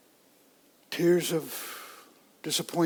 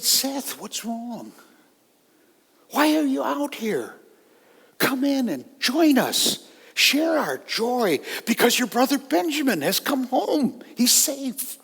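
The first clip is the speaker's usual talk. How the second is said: a lightly voiced father, higher, coaxing his son inside; gentle pleading father; 8 semitones higher, 3 dB louder than usual.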